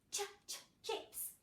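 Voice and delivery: Ch, ch, then Chips, soft repeated ch bursts; segmented ch. Shown as a demonstration. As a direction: break the ch off before Chips. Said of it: "Ch or sh".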